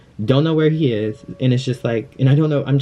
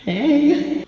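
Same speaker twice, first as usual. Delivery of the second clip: high-pitched little hey; mimicked greeting; 13 semitones higher than usual. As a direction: greet a fan in a small, high voice. Shy reply to excited fans.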